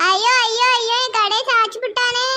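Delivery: hammed-up public wailing; distressed outcry. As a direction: cry out over your wrecked stall. Vendor wails as stall is overturned.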